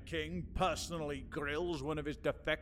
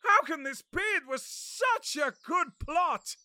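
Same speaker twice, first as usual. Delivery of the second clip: higher, shouted despair; shocked outcry; 9 semitones higher, 3 dB louder than usual. Acted. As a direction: cry out in dismay. Catesby hears the plot failed.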